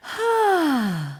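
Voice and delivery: high sliding sigh down; demo sigh. Shown as a demonstration. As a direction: let out a long falling sigh. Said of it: "can you just quickly give me a sigh".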